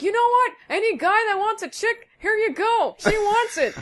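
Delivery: high, loud retort in anger; angry high outburst. Retold or imitated. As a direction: snap back high and loud. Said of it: "And then I got so angry".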